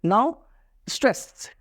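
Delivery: ordinary delivery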